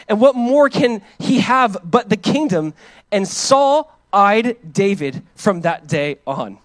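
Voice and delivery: slow, lifted Bible reading voice; scripture reading. Acted. Pastor reading the Bible to the congregation, slow and lifted. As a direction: read the passage with weight and ceremony.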